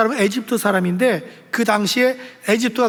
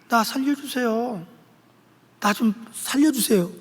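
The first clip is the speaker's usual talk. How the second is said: soft, understated cry for help; mock a feeble plea from inside a fire; politely quiet, half-hearted begging; too-meek plea; 5 dB quieter than usual. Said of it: "막 소리 질러야죠".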